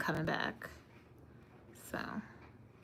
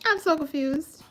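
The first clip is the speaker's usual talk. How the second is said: mock confusion; 9 semitones higher than usual. Repeats confusion after laughing.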